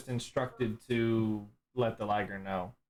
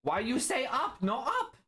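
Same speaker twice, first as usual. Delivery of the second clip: confused Liger; 11 semitones higher than usual. Acted.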